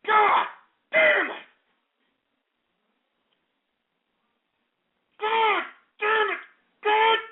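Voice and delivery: high, anguished bellow; each curse torn out separately and high; enraged cursing. Real, not acted. Host cursing alone after being pranked, enraged cursing.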